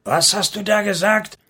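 high, incensed demand; outraged challenge. A narrator's reading. Enraged Levite challenges his opponent.

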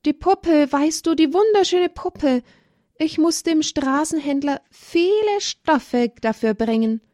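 put-on high, breathless child's voice about the doll; girl begging mother, excited about the doll; eager little girl. A narrator's reading. Olivia pleads for the doll.

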